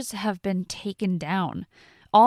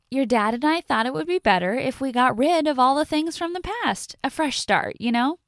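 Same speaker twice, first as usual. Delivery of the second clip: smug stepmother; 7 semitones higher, 5 dB louder than usual. Acted.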